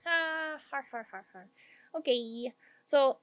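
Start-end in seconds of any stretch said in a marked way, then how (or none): 0.0-1.5 mock laugh "har har". Mocking laugh at partner.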